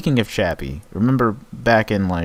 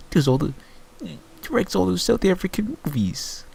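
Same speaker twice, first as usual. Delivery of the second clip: put-on a faint try at a South African accent, rising higher; failed South African accent; 11 semitones higher than usual. Friend tries a South African accent while recalling a director's name, rising. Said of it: "I'm trying to do a South African accent".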